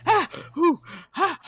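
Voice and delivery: loud, high "ah" and "ooh" gasps; hammed-up panicky yelps; mock panicked gasps. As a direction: act out short bursts of panic. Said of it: "So I'm going".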